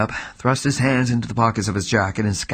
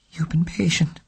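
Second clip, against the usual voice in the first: whisper; 8 semitones higher than usual. Acted. Trembling woman composes herself.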